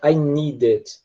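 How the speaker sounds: "I need it" with a real, clear D; British "I need it", D fully sounded; British true D. Shown as a demonstration. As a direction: say "need it" as "nee-dit", no flap. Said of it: "pronuncia como um D mesmo".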